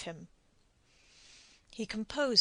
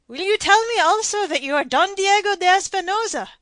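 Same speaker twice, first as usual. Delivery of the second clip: much higher, much louder, frantic question; distraught don; 11 semitones higher, 13 dB louder than usual. A narrator's reading. Don Diego demands the stranger's identity, distraught. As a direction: near hysteria.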